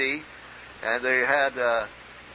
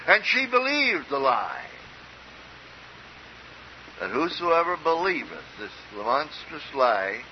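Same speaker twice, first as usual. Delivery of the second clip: measured, ringing scripture recitation; preaching; 5 semitones higher than usual. Acted. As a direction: lift each phrase and pause for weight.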